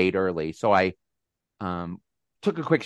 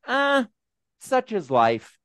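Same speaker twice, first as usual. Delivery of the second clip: retold shrug; 11 semitones higher, slower than usual. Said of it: "turned around a little startled".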